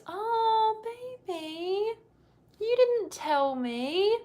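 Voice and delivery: very high, drawn-out mock coo; bride's voice impression. Bride to fiance about his siblings, mimicked high cooing. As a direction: imitate her cooing sweetly up high. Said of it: "she speaks, like, kind of like this".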